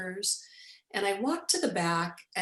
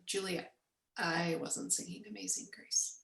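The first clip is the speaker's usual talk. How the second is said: quiet denial; 8 dB quieter than usual. Retold or imitated. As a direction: say it softly, as a puzzled friend setting things straight. Friend quietly denies he sang.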